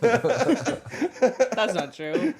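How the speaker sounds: drawn-out laughing denial; laughing protest. Real, not acted. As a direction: drag it out through a laugh. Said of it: "playful protest".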